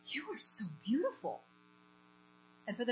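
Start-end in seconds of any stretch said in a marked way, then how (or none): 0.0-1.4 breathy gasp of wonder at someone's beauty; retold awestruck gasp; awed gasp. Awed outburst to the woman.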